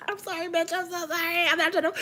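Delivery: high, put-on sorry voice; hammed-up high apology; squeaky mock apology.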